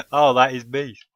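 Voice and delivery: ordinary delivery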